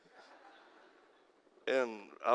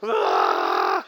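imitating a high man; 6 dB louder than usual. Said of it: "high and just, you know".

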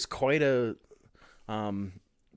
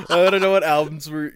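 normal talking voice

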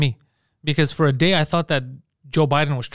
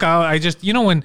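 ordinary talk

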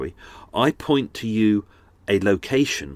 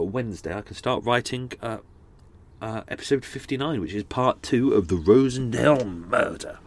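usual speaking voice

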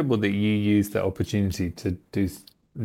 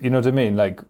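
ordinary delivery

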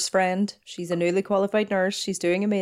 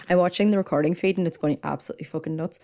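ordinary talk

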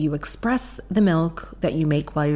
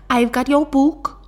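put-on Newcastle accent; 9 semitones higher, 5 dB louder than usual. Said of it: "she approached me and she said".

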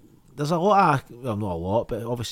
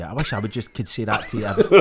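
ordinary talk, nothing marked

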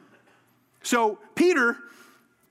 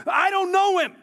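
emphatic denial; 12 semitones higher, 5 dB louder than usual. Acted. Peter denying Jesus to his accusers, emphatic denial. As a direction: deny it hard, pitched way up.

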